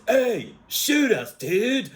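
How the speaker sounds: high, slow, swooping dude voice; Bill and Ted. Retold or imitated. Bill and Ted after being shot, imitated dude voice. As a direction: drag it out like an amazed dude. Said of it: "Bill and Ted says".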